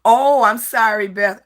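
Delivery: loud and high, sudden sorry; quick high sorry to a viewer; excited apology. Real, not acted. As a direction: burst out the apology.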